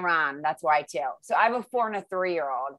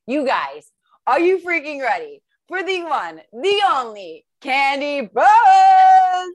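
building, much louder and higher; announcer intro; 7 semitones higher, 8 dB louder than usual. Acted.

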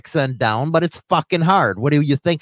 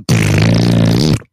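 loud, low raspberry; rumbling lip-buzz fart sound; imitated fart noise; 6 dB louder than usual. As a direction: blow a long, loud mock fart. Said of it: "That way I go".

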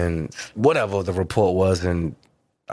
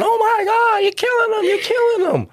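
mock scream. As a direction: shriek like a panicked girl watching a fight. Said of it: "started screaming".

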